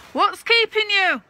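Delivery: high, loud call across the yard; calling from the wall. Acted. Mary Ann calling the men home from the wall, high and loud. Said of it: "she calls from the top of the haggard wall".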